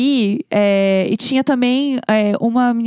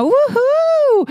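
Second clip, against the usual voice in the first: high, bright party whoop; cheering whoop. Retold or imitated. Alone at the online party, whooping at the screen, excited cheer. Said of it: "eu tava assim".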